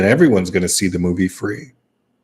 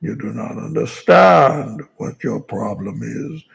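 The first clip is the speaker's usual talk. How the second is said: slow muffled villain voice; a hint of masked Bane; 3 dB quieter than usual. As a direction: speak slowly as if through a mask. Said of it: "there's the voice of Bane".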